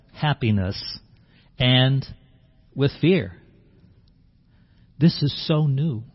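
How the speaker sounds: ordinary talk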